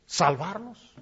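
low, soft, incredulous; God quietly doubting; hushed disbelief. Retold or imitated. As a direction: murmur it in disbelief. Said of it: "Y Dios diciendo".

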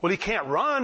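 usual speaking voice

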